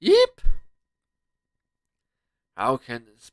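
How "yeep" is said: very high, loud squeak of fright; frightened yelp. Acted. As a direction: yelp in sudden alarm.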